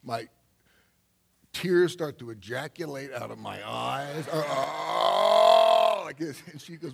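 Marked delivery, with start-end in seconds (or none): 4.3-6.0 a loud wailing "oh" of overwhelm; tearful cry. Retold or imitated. Telling the audience about tears welling up, a loud wailing oh. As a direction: let the tears burst out in one big cry.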